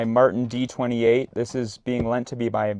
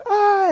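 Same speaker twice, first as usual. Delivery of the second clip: short high shriek; high scream; 10 dB louder than usual. Retold or imitated. Scared girls in the next tent, short high shriek.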